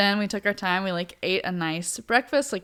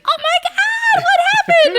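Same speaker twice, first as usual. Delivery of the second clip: much higher, loud gushing "oh my God"; excited passersby; 8 dB louder than usual. Retold or imitated. Imitating strangers on the trail seeing the sign, squealing high. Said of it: "every person that we passed was like".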